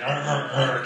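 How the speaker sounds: playful repeated dog growls; imitated dog growl. Dog playing tug with its owner, mock growling. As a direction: growl like a dog tugging a scarf. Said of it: "and the dog's like".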